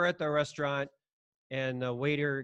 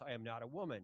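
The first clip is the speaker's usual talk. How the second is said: quiet, low, half-swallowed protest; muttered under breath; 5 semitones lower, 15 dB quieter, faster than usual. Retold or imitated. Telling friends what he wouldn't yell at the restaurant. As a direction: say it softly, just to yourself. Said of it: "Christians aren't supposed to yell".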